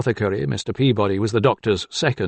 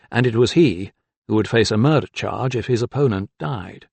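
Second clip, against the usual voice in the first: ordinary delivery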